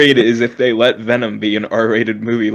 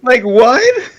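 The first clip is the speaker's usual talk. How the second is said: mock baffled; 12 semitones higher, 6 dB louder than usual. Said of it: "he's like".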